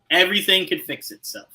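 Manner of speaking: ordinary talk, nothing marked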